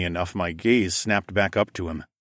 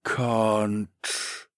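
one long, stretched word; slow slothman drone; 3 dB quieter than usual. A narrator's reading. Slothman explaining his brother's limits, slow drone. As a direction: drag the single word out like a sloth.

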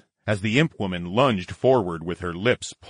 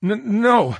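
nervous, higher, louder stammer; 13 semitones higher, 5 dB louder than usual. Acted. Charles pinned under imp woman, stammering. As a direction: trip over the first sound. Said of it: "I stammered".

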